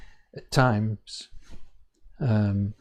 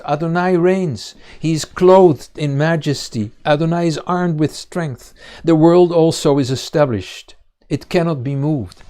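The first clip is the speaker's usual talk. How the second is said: loud, emphatic psalm reading; scripture reading; 4 semitones higher, 7 dB louder than usual. Acted. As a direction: proclaim the psalm in a raised, firm voice. Reads the psalm aloud.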